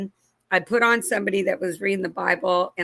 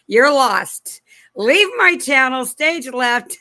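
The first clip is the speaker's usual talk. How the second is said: loud, higher teasing brush-off to the viewers; playful outburst; 5 semitones higher, 7 dB louder, slower than usual. Real, not acted. Telling lost viewers to leave her channel, loud playful brush-off. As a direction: shoo them out with a loud, raised mock scold. Mock dismissing clueless viewers.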